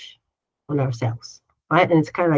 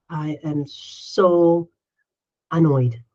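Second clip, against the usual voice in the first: slow calm naming; 3 semitones lower, slower than usual. Shown as a demonstration. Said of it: "just taking a minute and say".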